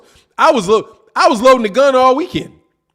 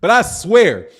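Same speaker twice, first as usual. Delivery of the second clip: usual speaking voice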